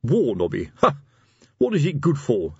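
put-on mildly scornful old sergeant; disdainful grunt. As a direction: dismiss it with a grunted scoff. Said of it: "Fred grunted his disdain".

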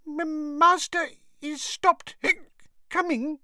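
high squeaky elf, hiccuping through tipsy words; slurred and slow, breaking off for hiccups; drunk hiccuping elf. A narrator's reading. Drunken Winky answers Harry about her master, hiccuping.

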